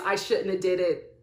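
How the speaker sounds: ordinary delivery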